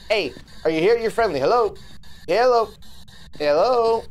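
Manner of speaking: streamer calling out for the ghost. Real, not acted.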